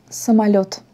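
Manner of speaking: самолёт with the stress on the final syllable, лёт; stress on last syllable. Shown as a demonstration. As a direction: lean on the end of самолёт: sa-ma-LYOT. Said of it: "l'accent sur la dernière syllabe".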